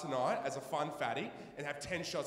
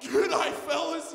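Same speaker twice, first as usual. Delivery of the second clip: higher, louder put-on g'day; forced blokey g'day; 11 semitones higher, 6 dB louder, much slower than usual. Terrified newcomer greeting the blokes, forced shout. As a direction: fake confidence, shout it back. Terrified reply trying to fit in.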